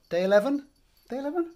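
squeaky high doubtful question; high playful query; mock unsure. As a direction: pitch it way up, unsure of yourself. Playfully unsure of day number.

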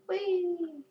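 long, high-pitched "whee"; playful whee. Pretend. Gamer dropping down a hole, playful high whee. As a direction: let out a high whee, gliding down.

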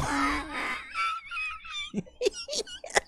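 high squealing laugh fit; shrieking laugh. Real, not acted. Streamer cracks up at the lyric with a shrieking laugh.

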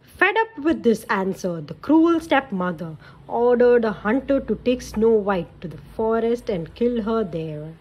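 put-on lively read-aloud for kids; storybook narration. A narrator's reading. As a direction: tell it like a bedtime story. Narration continues.

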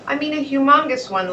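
usual speaking voice

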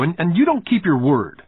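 put-on high-pitched accusations from a wronged little boy; acted child's heated tirade; indignant outburst. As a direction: pile up the grievances, worked up. Boy accusing boss in acted quarrel.

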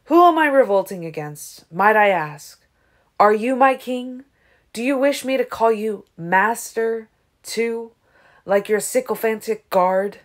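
loud, defiant questioning; angry growled challenge. A narrator's reading. Garrett defies Aro before the guard, growling. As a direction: fire each question at him with indignant force. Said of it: "he growled".

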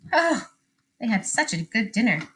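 plain reading voice

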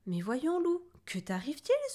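high, astonished questioning; stunned. A narrator's reading. Louve taken aback by Lou's outburst, stunned. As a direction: ask in sheer bewilderment. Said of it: "souffla Lou stupéfaite".

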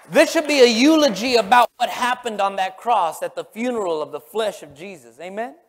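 ordinary talk, nothing marked

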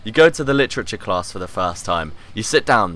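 usual speaking voice

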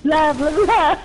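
a hint of a cartoon vampire, laughing; mimicking how the friend made her sound; mock vampire. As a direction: lightly ham a vampire blah blah. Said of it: "I don't speak like this".

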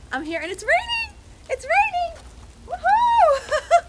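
high, loud squeals of joy at the rain; excited whoop at the rain; delighted squeal. Real, not acted. Rain starts, she squeals with joy.

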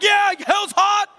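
high, loud, slow preacher cry; hammed-up shouting preacher; mock revival shout. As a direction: holler it like a tent revival. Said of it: "You're like".

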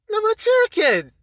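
high, drawn-out shriek of disbelief; loud, scornful high-pitched exclamation; incredulous yell. Real, not acted. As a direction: squeal the nickname loudly, in disbelief. Incredulous repeat of the nickname.

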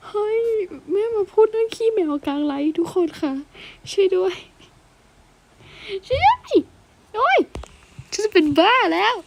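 high flustered wailing; panicked squeals. Real, not acted. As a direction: shriek for help in comic embarrassment. Mother talks cat poop mid-stream.

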